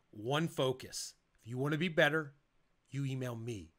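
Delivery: slowed right down, each phrase spaced out; unhurried, deliberate call to action; slow and weighty. Shown as a demonstration. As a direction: lay it out slowly so it sounds important. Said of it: "I tried to slow down my voice".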